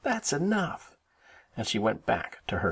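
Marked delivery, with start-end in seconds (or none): 0.0-1.0 high little-sister voice, fed up; high-pitched protest. A narrator's reading. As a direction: put an end to the teasing. Sister protests, fingers in ears.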